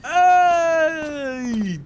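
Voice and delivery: high loud "Hey!" of discovery; mock surprised shout. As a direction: call out like you caught him.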